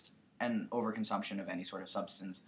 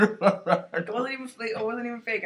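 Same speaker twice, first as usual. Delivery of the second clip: laughing; 13 semitones higher, 9 dB louder, faster than usual. Real, not acted. Laughing through words.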